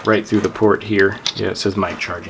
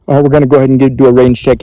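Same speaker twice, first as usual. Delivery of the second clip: louder, carrying call for a range check; range-check call at full volume; loud radio call; 2 semitones higher, 11 dB louder, much faster than usual. Real, not acted. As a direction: push the voice out over two miles.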